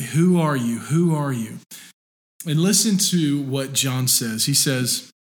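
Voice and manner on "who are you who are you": lightly voiced insistent questioners, pressing; a hint of impatient priests demanding an answer; pushy demand. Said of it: "they're pushing".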